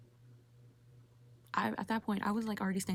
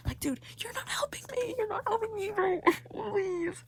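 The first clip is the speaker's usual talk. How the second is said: high, pleading whine; drawn-out whining complaint; whiny exasperation; 13 semitones higher, 3 dB louder, slower than usual. Real, not acted. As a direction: plead in mock despair, then laugh. Fed up with unhelpful clerk.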